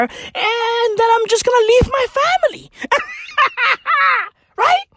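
cackling at his own joke; laughing shout. Real, not acted. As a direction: crack up while yelling the punchline. Joke about leaving family.

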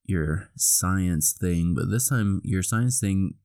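ordinary talk, nothing marked

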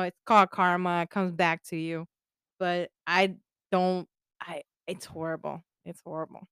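ordinary talk

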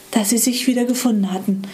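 usual speaking voice

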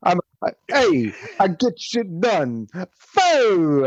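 a bragging New York tough guy; mock Cuomo. Mocking Cuomo with a New York tough-guy swagger.

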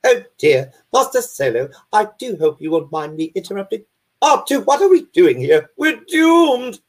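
high-pitched, fussy robot butler; C-3PO. Acted. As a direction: play a fretful, overpolite protocol droid. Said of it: "C P O's fun".

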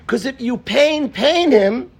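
fiery rendering of the verse; preaching the verse. Shown as a demonstration. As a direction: proclaim God's warning. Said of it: "One more time".

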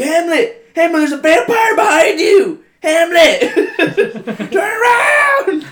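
high, loud frantic yelling from the rafters; hammed-up screaming heckler; mock scream. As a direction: shout the warning at the top of your lungs. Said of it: "just screaming".

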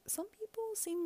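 high-pitched, puzzled retelling; imitated younger self. Voicing younger self to mom.

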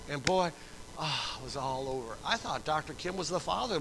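usual speaking voice

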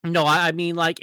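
ordinary talk

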